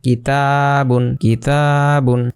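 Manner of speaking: কিতাবুন said slowly, the a after ত held one beat; one alif stretch. Shown as a demonstration. Teacher modelling কিতাবুন for the class, slowly. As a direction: draw out কিতাবুন syllable by syllable. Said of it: "কারণ উপরে একটা খাড়া জবর আছে".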